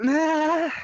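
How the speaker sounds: loud high yelp; sudden pained yelp. Acted. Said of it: "She heard a faint yelp".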